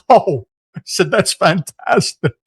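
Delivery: laughing, high and loud, drawn out; delighted relief at being let go; gleeful disbelief. Retold or imitated. Boss telling him he's laid off, laughing with glee.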